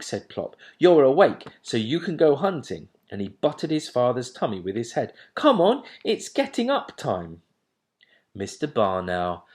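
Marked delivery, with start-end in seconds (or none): 0.8-7.4 nagging owlet. A narrator's reading. Plop nagging father.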